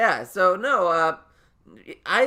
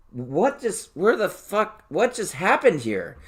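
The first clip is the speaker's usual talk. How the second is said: higher, swooping baffled questions; mock bewilderment; 5 semitones higher, 5 dB louder, slower than usual. Waking stoned to a rearranged room, retold bewilderment. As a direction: play up waking up totally baffled.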